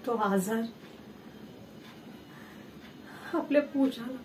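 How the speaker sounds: low, soft, halting with long pauses; subdued sorrow. Acted. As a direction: trail through a painful family memory. Grieving over Pooja's illness. (दुःखाने हळू, थांबत थांबत)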